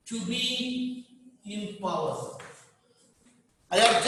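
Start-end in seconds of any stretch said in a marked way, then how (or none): 0.1-2.4 very slow, softer dictation; drawn-out words with a gap, quieter; dictation repeat. Real, not acted. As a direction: repeat it softly, drawn out for note-takers. Repeats it while students underline.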